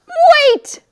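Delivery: high, loud cry to stop; urgent call. Acted. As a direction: yell to halt the story. Stopping the story.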